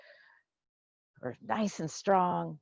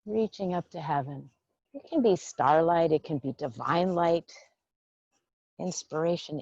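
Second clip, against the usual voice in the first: calm qigong teacher voice; guided meditation. Acted. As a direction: lead them gently through the breath. Leads a breathing meditation.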